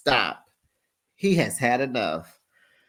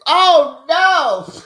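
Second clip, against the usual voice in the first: much higher and louder cry of disbelief; real gleeful oh no; real delighted shock; 13 semitones higher, 8 dB louder than usual. Real, not acted. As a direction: burst out scandalized. Reacting to the recap's best line.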